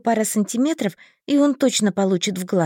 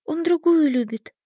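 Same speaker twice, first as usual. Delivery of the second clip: sad sigh; 7 semitones higher than usual. A narrator's reading. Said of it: "печально вздохнула я".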